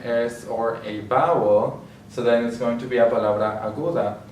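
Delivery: normal talking voice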